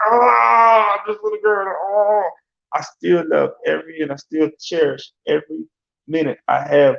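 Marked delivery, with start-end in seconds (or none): 0.1-2.3 a put-upon father mocking his own irritation; mock irritated groan. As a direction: act fed up with the kids, half joking. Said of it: "just like".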